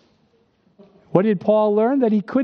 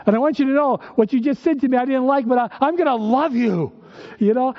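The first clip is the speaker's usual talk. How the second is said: higher, faster, through forced patience; husband telling his wife he'll love her, strained higher; 6 semitones higher than usual. Retold or imitated. Husband to wife, forced patient love.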